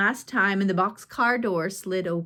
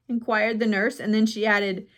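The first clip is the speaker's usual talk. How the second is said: ordinary delivery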